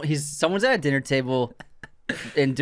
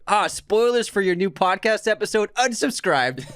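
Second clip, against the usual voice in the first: mock outrage; 7 semitones higher than usual. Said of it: "he wrote".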